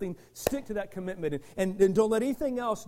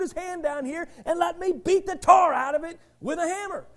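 loud, high, driving sermon delivery; full-force preaching; 8 semitones higher, 7 dB louder than usual. Acted. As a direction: preach it hard to the congregation.

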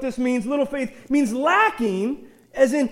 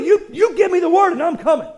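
usual speaking voice

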